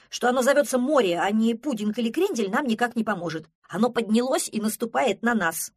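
agitated protest. Acted. Пронырсон warns the sea is advancing on them, agitated. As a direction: argue back loudly, worked up.

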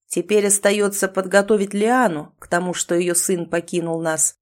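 plain reading voice

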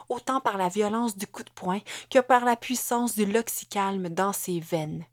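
plain reading voice

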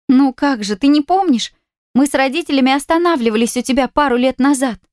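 high, lightly voiced girl; a light girlish surprise; young girl voice. A narrator's reading. Girlfriend answers, surprised.

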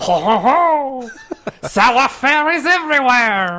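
very high, loud falsetto silliness; cartoon island character. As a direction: squeal it out as a hammed-up cartoon figure. Continues joking island character voice.

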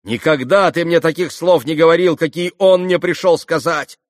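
high, loud, indignant rebuke; heated, ringing reproach; furious shout. A narrator's reading. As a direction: hurl it at him angrily. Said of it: "яростно крикнула ему Грушенька".

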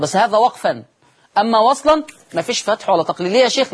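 ordinary talk, nothing marked

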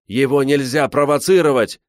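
high, loud frantic warning; shouting. A narrator's reading. Зазуля warns against provoking the bear, shouting. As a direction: shout it in urgent protest. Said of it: "кричал Зазуля".

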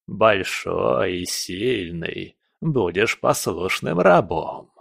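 slow, savoring villain voice; gloating old villain. Acted. Old spirit claims a strong slave, gloating slowly. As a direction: draw it out with relish.

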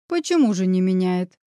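ordinary delivery

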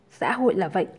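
usual speaking voice

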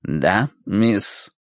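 low, impassive butler. A narrator's reading. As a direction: answer without any feeling. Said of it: "бесстрастным голосом".

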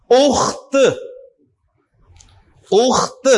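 اخت with an audible t at the end; each اخت closed on a separate t sound; final t sounded. Shown as a demonstration. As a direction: release the last t of اخت clearly. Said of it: "تو تا کی آواز آئے گی".

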